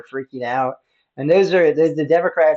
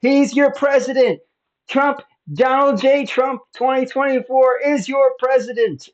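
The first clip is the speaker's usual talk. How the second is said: high, loud triumphant proclamation; imitated screaming; 13 semitones higher, 6 dB louder than usual. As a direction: holler it back at an angry neighbor.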